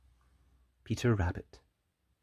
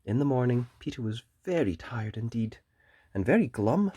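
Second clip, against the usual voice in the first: ordinary delivery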